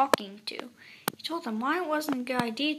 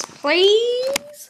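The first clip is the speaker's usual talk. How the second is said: high, loud, drawn-out rising plea; wheedling, stretched "Please"; pleading; 10 semitones higher, 9 dB louder than usual. A narrator's reading. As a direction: beg with a long upward slide. Begging for toy cats.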